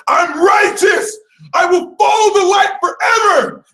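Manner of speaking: high, loud, hammed-up shout; shrill self-righteous battle cry; righteous proclamation. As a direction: declare it like a zealous crusader. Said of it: "like, super righteous".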